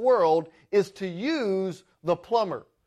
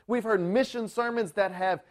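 usual speaking voice

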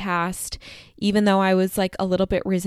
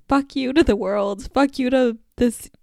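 mild mock yell, pitched higher; half-voiced outburst, raised in pitch; retold yell; 5 semitones higher, 3 dB louder than usual. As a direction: re-enact a yell at the world without fully shouting. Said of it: "then I started yelling".

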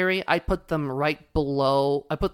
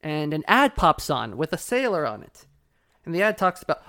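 ordinary talk, nothing marked

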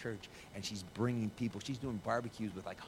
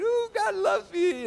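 very high, loud, drawn-out squeal; hammed-up ecstatic cry; mock elation; 15 dB louder, much slower than usual. Acting out elation when doing good.

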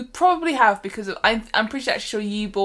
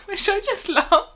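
gushing through a laugh; 10 semitones higher than usual. Real, not acted. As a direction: laugh it out, delighted. Gushing over the character Pickles.

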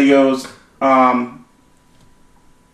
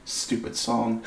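much softer muttered aside; muttered to herself; 11 dB quieter, much slower than usual. Retold or imitated. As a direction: mutter it under your breath. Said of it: "She goes".

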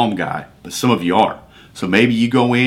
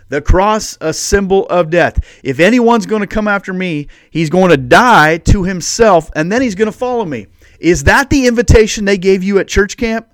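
higher and louder, driving each clause home; full-force preaching; 7 semitones higher, 5 dB louder than usual. Acted. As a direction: preach the call like a challenge.

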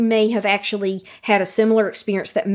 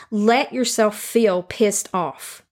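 slow emphatic; much slower than usual. Shown as a demonstration. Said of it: "slow emphasis".

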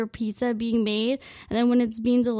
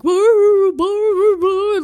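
high, loud imitated dog barks; mock yapping dog; barking dog; 9 semitones higher, 11 dB louder, much slower than usual. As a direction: bark like a frantic dog at the door. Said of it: "the dog would be barking her head off".

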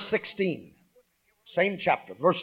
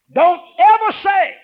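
much higher, louder cry; forceful warning; 10 dB louder than usual. Real, not acted. Pastor warning the congregation, loud and high. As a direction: shout the warning out. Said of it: "preacher warning congregation".

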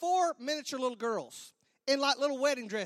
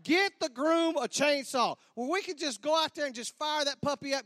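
ordinary talk

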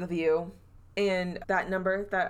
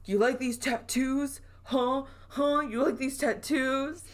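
flaunt the tattoos, goading the ghost; taunting show-off; 7 semitones higher than usual. Retold or imitated. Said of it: "he's like".

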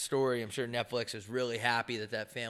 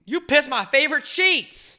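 very high, loud squawking dad; mock dad voice; 14 semitones higher, 9 dB louder than usual. The father complains to his son, very high and loud. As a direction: whine about the sheets.

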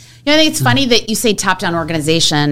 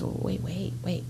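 quietly stopping someone, wait wait; soft, hushed call to a colleague; 17 dB quieter than usual. Retold or imitated. Stopping a colleague who ignored her.

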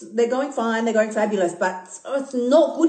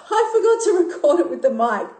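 laughing through it, pitch higher; 6 semitones higher than usual. Real, not acted. Host laughing at forgetting to record the mic. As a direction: laugh at your own blunder as you say it. Laughs at her own mistake.

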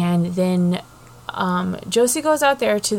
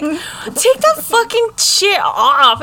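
higher, louder, slow put-on bossy voice; hammed-up order, drawn out; mock commanding man; 10 semitones higher, 5 dB louder, slower than usual. Maine telling Josie to remove her corset, hammed-up demand.

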